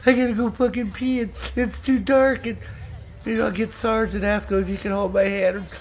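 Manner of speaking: put-on high, whiny scared-kid voice; mock a frightened friend who needs the toilet; mock scared whine. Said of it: "I hear fucking A I E crying over there".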